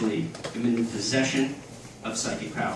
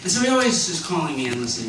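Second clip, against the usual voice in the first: ordinary talk, nothing marked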